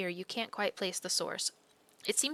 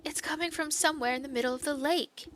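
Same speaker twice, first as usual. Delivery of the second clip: put-on higher, yearning young maiden voice; lovesick maiden; 9 semitones higher than usual. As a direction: call out hopefully toward the lake. Maiden character voice.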